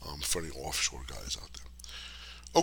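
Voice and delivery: low, slow whisper to the offshore guys; slow hushed aside for offshore bettors. Real, not acted. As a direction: drop to a hush for the insiders.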